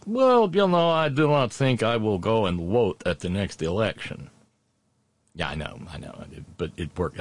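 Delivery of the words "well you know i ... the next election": high, hammed-up Russian man with rolling vowels; mock Russian voice with W sounds for V; Russian accent. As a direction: play an earnest Russian citizen, thick accent. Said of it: "And he says".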